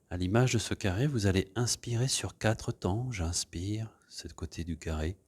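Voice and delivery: gentle meditation voice leading breathing; guided meditation. Acted. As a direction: ease the class into stillness. Leads a guided relaxation.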